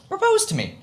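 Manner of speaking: imitating a teasing girl; girl's playful request. Lissa teasing the storyteller at the racetrack in a high mock girly voice. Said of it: "she said".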